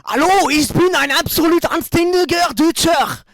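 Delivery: loud, high, slow German caricature; hammed-up German bellow; German accent. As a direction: shout it like a parody German. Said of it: "l'accent allemand".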